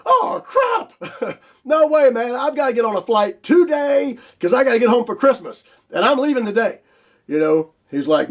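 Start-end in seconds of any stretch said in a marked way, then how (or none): 0.1-6.8 way higher, near yelling in dismay; panicked outburst. Retold or imitated. Telling the logistics guy he must fly home for Christmas, high panicked yelling. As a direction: squeal it out, desperate to get home.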